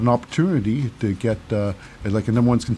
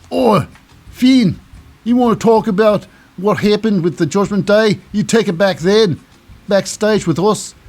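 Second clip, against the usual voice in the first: higher-pitched, hammed-up Australian scolding; Australian accent; 5 semitones higher, 3 dB louder than usual. Rhea Ripley tells off Finn Balor, put-on Australian accent. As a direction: put on a rough-and-ready Australian accent, telling him off. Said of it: "This my Australian accent".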